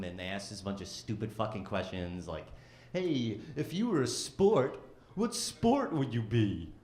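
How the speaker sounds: ordinary talk, nothing marked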